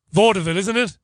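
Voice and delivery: ordinary talk, nothing marked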